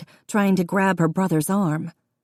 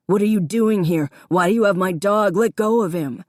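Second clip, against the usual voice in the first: angry demand; 3 semitones higher, 4 dB louder than usual. A narrator's reading. Davy demanding Connor let go of his dog, angry. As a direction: confront him, voice rising.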